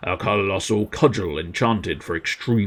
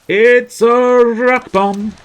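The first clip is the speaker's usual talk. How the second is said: slow, loud and high boom; dramatic announcement; 13 semitones higher, 11 dB louder, much slower than usual. Acted. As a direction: announce it like a wrestling ring caller. Announcing new enemy dramatically.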